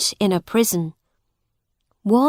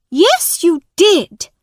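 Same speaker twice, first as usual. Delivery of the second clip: high, loud accusation; accusing woman; 9 semitones higher, 6 dB louder than usual. Acted. Angry woman to the boy, loud accusation. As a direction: jab it back at him. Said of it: "she said".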